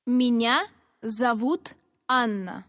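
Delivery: ordinary delivery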